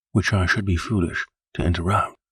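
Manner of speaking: ordinary delivery, nothing marked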